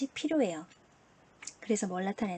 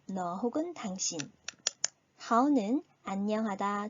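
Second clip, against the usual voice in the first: ordinary talk, nothing marked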